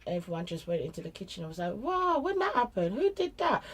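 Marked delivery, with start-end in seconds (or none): 1.7-3.6 high-pitched imitation of surprised children; mock astonishment. Mum imitating children seeing the messy kitchen, high-pitched astonishment. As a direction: squeal it as if you know nothing about it.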